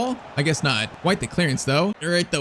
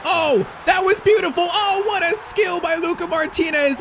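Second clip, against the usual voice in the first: ecstatic goal shout; 12 semitones higher than usual. Real, not acted. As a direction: yell it like a stunning goal just went in. Player scores final's first goal.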